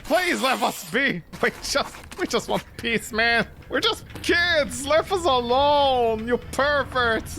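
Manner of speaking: voicing the rabbit. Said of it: "The rabbit is like".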